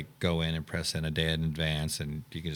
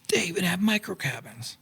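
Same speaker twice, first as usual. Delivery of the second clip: much higher, thrilled squeal of discovery; amazed delight; 13 semitones higher than usual. Retold or imitated. Couple pulling into the camp, thrilled and amazed. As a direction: gush over the tiny cabins like kids.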